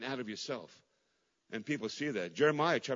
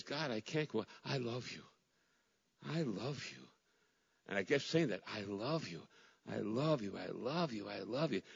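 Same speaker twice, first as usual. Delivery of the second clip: soft, much quieter, choked murmur; tearful prayer; 3 semitones lower, 6 dB quieter than usual. Retold or imitated. Grieving pastor telling God he loves him, softly tearful. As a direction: whisper love to God through tears. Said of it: "And I was crying".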